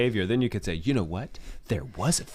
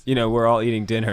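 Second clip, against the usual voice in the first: ordinary delivery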